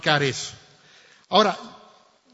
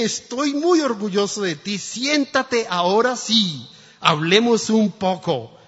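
proud father; 5 semitones higher, 7 dB louder than usual. Acted. Proud father to grown son.

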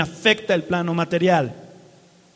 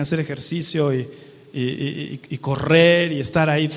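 ordinary talk, nothing marked

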